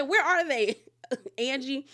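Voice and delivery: high, laughing question; a laughing, teasing question; laughing disbelief. Retold or imitated. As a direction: ask it in amused disbelief. Retelling her own question.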